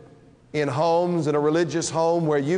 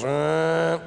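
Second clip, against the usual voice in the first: a sung, droning "uh" like a treadmill beep; machine noise. Retold or imitated. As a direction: imitate the machine's signal tone. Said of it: "something like this. It goes".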